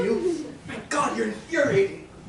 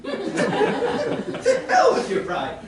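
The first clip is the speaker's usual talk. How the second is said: laughed out, higher and very slow; laughing retort; 12 semitones higher, 3 dB louder, much slower than usual. Acted. Gentleman mocks the performer's pride, laughing high and slow. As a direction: laugh the insult out.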